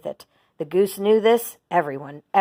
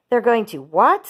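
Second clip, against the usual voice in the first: shocked cry; 4 semitones higher, 5 dB louder than usual. A narrator's reading. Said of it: "screamed Wilbur".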